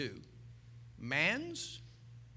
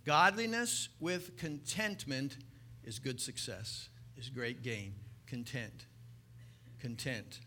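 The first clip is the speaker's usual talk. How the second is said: low, much softer, lingering on content; soft sermon; 5 semitones lower, 5 dB quieter, slower than usual. Acted. Preacher repeating the key word, soft and low. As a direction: let the word settle gently.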